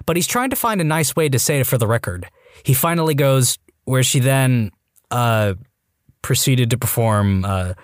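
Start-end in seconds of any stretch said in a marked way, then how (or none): 3.8-7.7 halting and low, with awkward pauses around each uh; stalling awkwardly in a subdued voice; uncomfortable, hesitant. Acted. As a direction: feel your way reluctantly toward an embarrassing word. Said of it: "starts to get a little uncomfortable".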